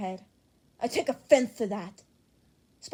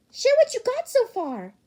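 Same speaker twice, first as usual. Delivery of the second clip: excited shout; 10 dB louder than usual. Acted. Eager friend demanding the list.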